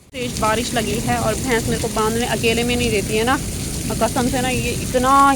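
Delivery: loud, talking over the downpour. Real, not acted. Alone in the downpour tying the buffalo, speaking loudly over the rain. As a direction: call it out over the pouring rain.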